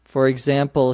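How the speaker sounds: ordinary talk, nothing marked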